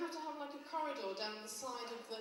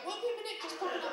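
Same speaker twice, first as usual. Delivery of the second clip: high, bright little excuse as they dash off; chirpy old dear hurrying to the loo; old student voice; 10 semitones higher, 7 dB louder, faster than usual. Retold or imitated. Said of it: "they go".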